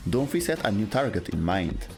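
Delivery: usual speaking voice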